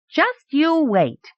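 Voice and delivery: put-on high, slow little-boy threat; childish defiance. A narrator's reading. Boy answering the lord who laughed, defiant.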